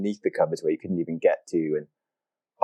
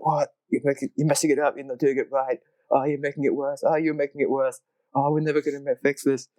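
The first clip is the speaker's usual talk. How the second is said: high, quick mock panic; mock frantic bickering; 9 semitones higher than usual. Friends griping at each other while cleaning, high mock panic. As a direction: fret over the mess like a nagging friend. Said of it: "we're like".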